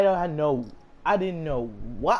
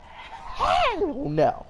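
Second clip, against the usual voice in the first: indignant refusal; 9 semitones higher than usual. Retold or imitated. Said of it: "I was like".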